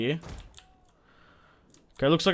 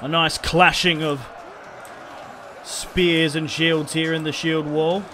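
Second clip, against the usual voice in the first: battle commentary; 2 semitones higher, slower than usual. Acted. Narrating the shield wall clash, mock commentator voice. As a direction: call the fight like a sports announcer.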